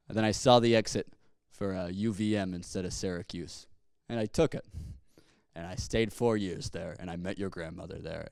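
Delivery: put-on grandfather's low, quiet Boston accent; old Boston accent. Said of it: "a Boston accent".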